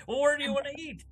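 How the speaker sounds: a mock fed-up partner, asking high; exasperated high question. Acting a couple picking dinner.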